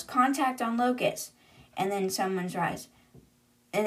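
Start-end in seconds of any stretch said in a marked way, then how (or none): none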